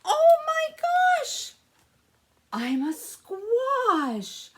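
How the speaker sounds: very high, loud, slow delighted cry; joyful discovery. Acted. Pumpkin realizing he's a squash, overjoyed and shouting. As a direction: burst out with amazed joy.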